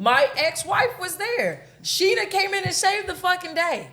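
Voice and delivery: high, excited exclamation. Real, not acted. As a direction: burst out with relief and glee. Excited retelling of rescue.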